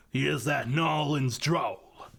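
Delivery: ordinary delivery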